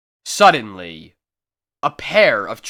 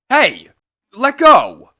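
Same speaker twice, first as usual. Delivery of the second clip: annoyed shout; 8 semitones higher, 9 dB louder than usual. Acted. Grabbed stranger shakes him off.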